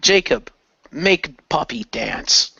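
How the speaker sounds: ordinary talk, nothing marked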